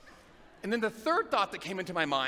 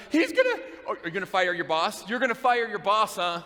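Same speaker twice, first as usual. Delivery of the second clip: mocking church guys; 3 semitones higher, 5 dB louder than usual. Guys ridiculing her plan to fire her boss, jeering.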